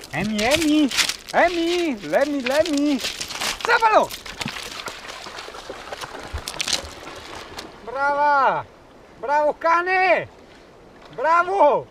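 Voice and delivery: excited praise for a dog; talking to a dog. Real, not acted. (lodando il cane con voce acuta)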